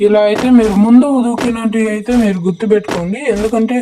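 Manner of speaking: usual speaking voice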